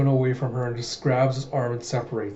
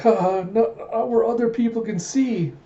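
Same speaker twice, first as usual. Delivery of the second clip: high, halting mock coyness; flustered playing along; 9 semitones higher than usual. Princess following the guard's lead, flustered and high. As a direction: stumble coyly through it, pitched up.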